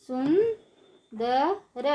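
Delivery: सुंदर stretched slowly, syllable by syllable; slow syllable drill. Shown as a demonstration. Teacher drawing out सुंदर slowly for children. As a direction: draw out सुंदर for the class. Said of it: "ऊंची आवाज़ में कैसे पढ़ेंगे".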